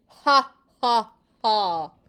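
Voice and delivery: loud, hammed-up giant's laugh; booming villain laugh. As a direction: boom out a terrible laugh. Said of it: "he laughed his terrible laugh".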